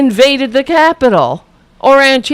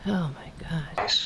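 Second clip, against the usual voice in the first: whispered disbelief; 6 semitones lower, 17 dB quieter than usual. Real, not acted. Whispered aside after the rant.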